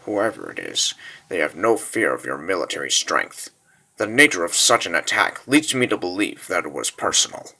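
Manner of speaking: low, soft and controlled; grave, subdued report. Acted. Officer explaining the attack to his lord, grave and low. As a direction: answer the outburst in a low, steady voice. Officer answers the angry ruler calmly.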